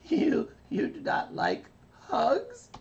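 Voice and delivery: very high, slow hurt cartoon voice; high hurt little kid voice; Carbonate voice. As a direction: ask it wounded, drawn out. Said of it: "And he's like".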